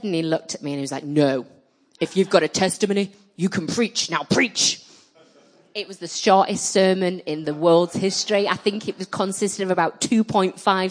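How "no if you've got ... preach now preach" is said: firm, louder retold order; commanding preacher. Preacher telling a reluctant singer to preach, mildly commanding. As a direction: lay down the order like an insistent preacher.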